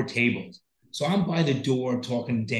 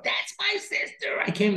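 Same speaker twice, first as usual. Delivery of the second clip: put-on a high, breathy send-up of the drunk sister, pitched way up; mock drunk sister; 8 semitones higher, 3 dB quieter than usual. Mocking the sister who made the seizure about herself, high and whiny. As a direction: whine it like the trashed sister making it about her.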